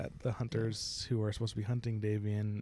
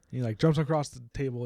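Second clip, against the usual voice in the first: ordinary talk, nothing marked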